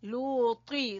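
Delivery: लूती with the t said full and heavy, falling at the end. Shown as a demonstration. Teacher stressing the full t sound of लूती. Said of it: "त्वा, पुर पढ़ेंगे".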